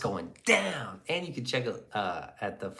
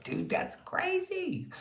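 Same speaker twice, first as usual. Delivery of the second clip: mock shocked voice; 4 dB quieter, much slower than usual. Mock reaction to chat conspiracy.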